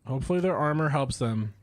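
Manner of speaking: ordinary delivery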